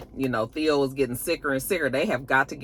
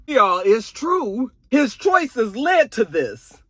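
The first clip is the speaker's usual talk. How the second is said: slow, loud preachy man; smug lecturer; 7 semitones higher, 5 dB louder, much slower than usual. Retold or imitated. Arsenio lecturing Diane about her father, slow preachy voice. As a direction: lay it down slowly like a know-it-all.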